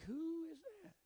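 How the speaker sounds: high, airy mock double take; a lightly played puzzled squint at the screen; curious who is that. Wondering who sent the request.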